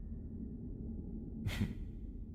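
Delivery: breathy, quiet mocking chuckle under the breath; a low amused scoff of a laugh; soft chuckle. Dragon laughing at the human's thick skull, quiet chuckle.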